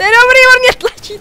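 high, loud, laughing delight; high laughing exclamation; amused high exclaim. Real, not acted. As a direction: squeal it with amusement. Laughing, being pushed in game.

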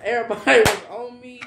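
laughing while telling it; embarrassed laughter at own story. Real, not acted. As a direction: talk through giggles.

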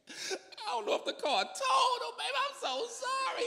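high, teary babbling; crying wife. Retold or imitated. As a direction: sob it out in a high voice.

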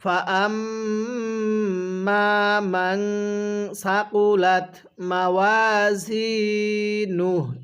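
very slow, drawn-out tilawat; slow recitation. Shown as a demonstration. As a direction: chant it slowly for students to repeat. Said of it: "কিভাবে পড়ব?". (ধীর তিলাওয়াত)

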